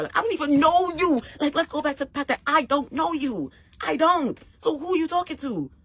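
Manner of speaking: high, loud, indignant retort; heated argument. Retold or imitated. Dater telling off an angry match on the phone, indignant.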